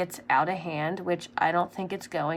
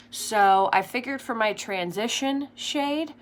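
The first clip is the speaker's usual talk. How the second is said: ordinary delivery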